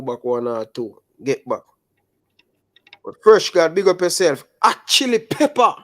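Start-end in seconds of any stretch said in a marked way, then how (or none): 3.0-5.7 high, loud hype for viewers; big up the chat at a high pitch; playful, high-pitched hype; excited shout-out. Real, not acted.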